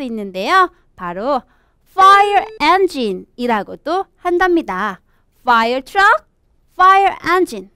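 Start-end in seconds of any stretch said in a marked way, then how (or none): none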